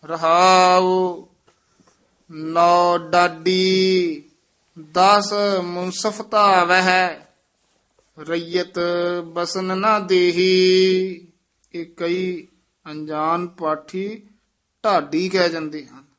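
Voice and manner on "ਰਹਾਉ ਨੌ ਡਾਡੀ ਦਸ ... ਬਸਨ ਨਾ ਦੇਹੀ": slow, raised reading of the verse; Gurbani recitation. Acted. Teacher reciting the shabad for the student. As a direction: chant the hymn out solemnly.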